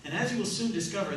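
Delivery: ordinary delivery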